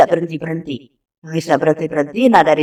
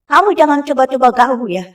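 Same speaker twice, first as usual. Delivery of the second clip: mild mock yell, raised in pitch; retold shouted challenge; 7 semitones higher than usual. Mother challenging whatever frightened her child, mild retold shout. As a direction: warn off an intruder in a higher voice, only half shouting. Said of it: "saya sambil teriak".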